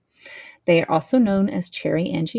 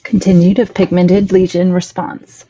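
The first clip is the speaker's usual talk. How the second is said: loud; 11 dB louder than usual. Real, not acted. Reading out a section heading.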